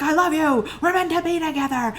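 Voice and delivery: high, loud mock declaration of love; yelling stalker. Stalker to the woman he drags to a cliff, mock yelling. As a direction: yell it like a desperate suitor, hammed-up. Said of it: "he's, like, yelling things".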